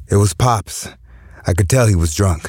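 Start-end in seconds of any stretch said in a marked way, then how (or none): none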